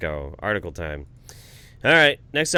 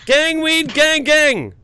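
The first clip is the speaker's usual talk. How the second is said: high, loud hype chant; mock rapper ad-lib shouting; meme shout; 9 dB louder, much slower than usual. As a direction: yell it like a hype man to drown them out. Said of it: "he's trying to drown us out now".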